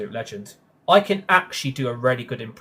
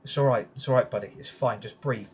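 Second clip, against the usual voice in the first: ordinary talk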